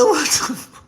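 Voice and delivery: high stunned gasp of disbelief; shocked. Retold or imitated. Telling followers how she reacted, high and shocked. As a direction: blurt it out in shock. Shocked at partner scratching on live.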